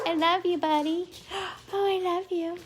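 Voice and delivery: high cooing pet voice with a gasp. Real, not acted. Owner to her poodle Toby, high cooing baby talk.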